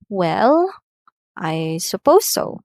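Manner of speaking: hesitant, grudging reply; fall-rise on "Well, I suppose so"; reluctant fall-rise. Shown as a demonstration. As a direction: give in unwillingly. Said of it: "example here for hesitation or reluctance".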